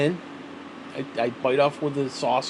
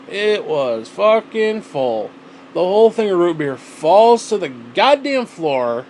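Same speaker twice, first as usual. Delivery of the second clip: exasperated; 3 semitones higher, 7 dB louder, slower than usual. Real, not acted. Root beer spills on floor.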